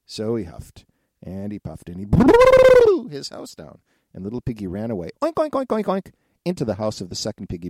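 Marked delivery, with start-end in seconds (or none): 1.9-3.8 high, loud, slow blown wail; long blowing howl. A narrator's reading. Narrator acts wolf's blow. 5.2-5.8 high, loud piggy oinks; oinking. A narrator's reading. Piggy runs away oinking.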